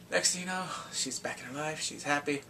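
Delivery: ordinary talk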